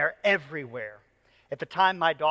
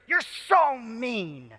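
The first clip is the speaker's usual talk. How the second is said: higher, drawn-out imitation of an upset kid; milder retelling of a child's outburst; vigorous child's accusation; 6 semitones higher, much slower than usual. Daughter turning on her dad, vigorous accusation. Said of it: "she says with such vigor".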